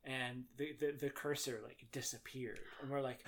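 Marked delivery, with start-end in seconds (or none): none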